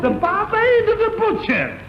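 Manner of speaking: high, grand title announcement; announcer style. Acted. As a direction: proclaim it again like a showman. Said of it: "announces the title once more".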